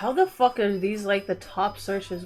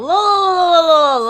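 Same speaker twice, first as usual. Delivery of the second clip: insistent loud look; 7 semitones higher, 9 dB louder, much slower than usual. Real, not acted. Streamer loudly insisting chat look at the skins. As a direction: pitch jumps up, loud and pushy.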